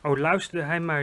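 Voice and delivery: ordinary delivery, nothing marked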